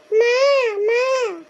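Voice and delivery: long, drawn-out call for mother; complaining, stretched-vowel holler. Acted. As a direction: wail out to summon mother. Daughter calls mother to complain.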